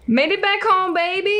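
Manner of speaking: high, loud victory shout; gleeful yell; triumphant cheer. Real, not acted. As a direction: celebrate making it back. Triumphant return home.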